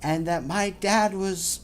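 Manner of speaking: slow, deliberate read-aloud of the post; dramatic reading. Acted. Host reads a Yahoo Answers post to co-host, slow dramatic reading. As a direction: read it out like a soap opera confession.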